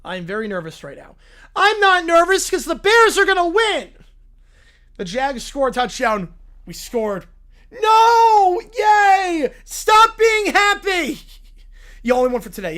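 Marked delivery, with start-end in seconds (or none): 1.6-3.9 high, loud bragging; loud boast. Acted. Little Brown Bear boasting about the Bears, loud. 7.7-11.2 high, loud, slow angry yells; furious shout. Acted. Little Brown Bear yelling at a happy fan.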